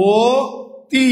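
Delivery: ও, then তি, split by a pause; syllable by syllable. Shown as a demonstration. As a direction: spell out ও তি as "o... ti". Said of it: "উচ্চারণের সময় কি হবে". (ও তি আলাদা করে, থেমে উচ্চারণ)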